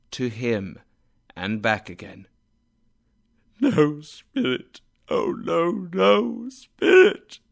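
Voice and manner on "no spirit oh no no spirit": slow, high, breaking plea; desperate pleading. A narrator's reading. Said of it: "he cried".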